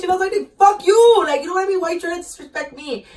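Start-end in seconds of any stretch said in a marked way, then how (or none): 0.6-1.2 loud, high retold outburst; angry comeback. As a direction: fire back, voice jumping up. Acted comeback in a fight story.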